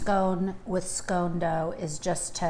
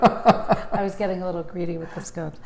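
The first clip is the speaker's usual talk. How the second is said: whispered through a giggle; laughing whisper; 3 semitones lower than usual. Real, not acted. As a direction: giggle it under your breath. Sheepish laughing aside.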